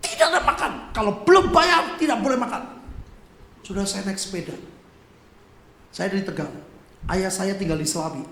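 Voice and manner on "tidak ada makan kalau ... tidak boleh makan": imitating a loud, high scolding shout; acted angry yell; angry debt collector. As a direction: bellow a harsh refusal as the collector. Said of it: "Itu debt collectornya marah".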